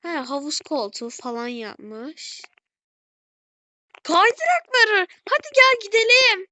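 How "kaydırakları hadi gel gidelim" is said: higher and louder, calling out eagerly; excited calling. Real, not acted. To Gülce about the slides, an excited high call. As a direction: call your friend over, thrilled.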